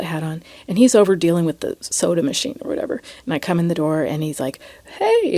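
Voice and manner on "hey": much higher, sing-song hey; imitating a sweet-voiced clerk; sweet friendly greeting. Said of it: "he's got this really sweet, nice voice".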